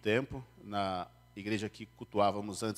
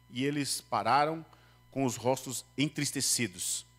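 higher, solemn Bible reading; scripture reading; 4 semitones higher than usual. Acted. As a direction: read the passage to the congregation. Reads the Bible passage aloud. (leitura bíblica solene)

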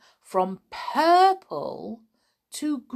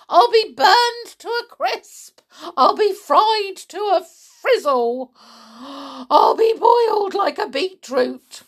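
put-on high, loud, wailing old woman; frantic yelling; 10 semitones higher, 7 dB louder than usual. A narrator's reading. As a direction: wail each doom louder than normal speech. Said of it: "she yelled".

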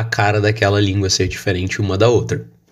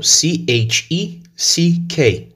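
spelling out letters; 6 semitones higher, much slower than usual. Shown as a demonstration. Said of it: "in English we say check".

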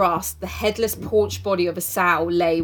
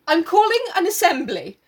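speaking loudly; 10 semitones higher, 4 dB louder than usual. A narrator's reading. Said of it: "Ralph spoke again loudly".

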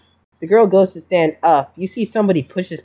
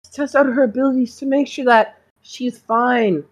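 higher, oily doctor voice pleading innocence; a smooth talking school doctor feigning innocence; smarmy doctor; 6 semitones higher than usual. Acted. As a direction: play the doctor as falsely sweet and wounded. GM holds doctor voice.

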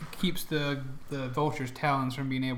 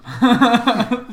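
loud laugh; 8 semitones higher, 14 dB louder than usual. Real, not acted. Laughing at gnome joke.

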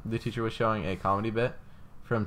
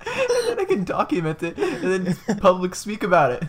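high, slow giggling scheme; gleeful laughing; 9 semitones higher, 6 dB louder than usual. Real, not acted. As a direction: laugh through the master plan.

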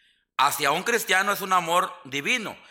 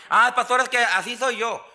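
higher, louder whiny excuse from a churchgoer; excusing church member; 6 semitones higher, 5 dB louder than usual. Retold or imitated. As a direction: play the member making excuses to the pastor. Congregant's excuse acted by preacher. (miembro de la iglesia que se excusa, más agudo y fuerte)

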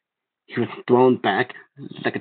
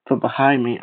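usual speaking voice

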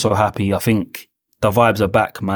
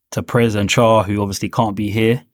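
ordinary talk, nothing marked

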